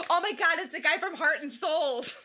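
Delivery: retold excited recognition. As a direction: relive the moment you both recognized him, thrilled. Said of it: "Very excited".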